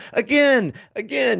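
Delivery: put-on a delighted child pleading to go again; excited kid. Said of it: "They go".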